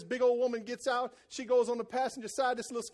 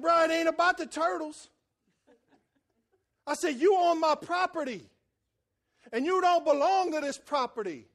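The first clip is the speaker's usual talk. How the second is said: angry; 5 semitones higher, 6 dB louder than usual. Retold or imitated. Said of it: "I ain't been this mad".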